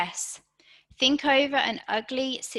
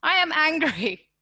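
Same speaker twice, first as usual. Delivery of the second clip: higher, firmly stressed "I am angry."; emphatic declaration; 5 semitones higher, 4 dB louder than usual. Retold or imitated. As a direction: declare "I am angry." with a raised, forceful voice, as if retelling it to her husband. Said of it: "that's the first thing I said to him".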